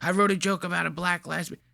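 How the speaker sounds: a mild creepy grin in the voice; mocking the guy. Host imitating the client pitching his joke to the masseuse. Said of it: "He told her this joke looking like this".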